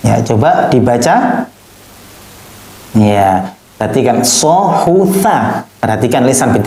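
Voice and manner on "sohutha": slow, syllable by syllable; slow reading. Shown as a demonstration. Teacher first reads Sohutha slowly, letter by letter. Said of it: "perbedaan lisannya".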